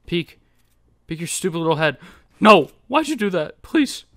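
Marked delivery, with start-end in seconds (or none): 2.4-4.0 high, whining protest after a loud no; distressed plea. Real, not acted. Gamer begging an opponent, distressed plea. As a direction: cry out, then plead in a rising voice.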